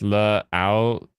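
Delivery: "La Ao" slowly split in two; slow syllable demo. Shown as a demonstration. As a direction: sound out "La Ao" as luh, then ow. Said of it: "It's pronounced".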